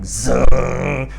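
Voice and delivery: drawn-out buzzing zzz; imitated buzzer. Shown as a demonstration. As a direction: imitate an alarm sensor going off. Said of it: "start buzzing like".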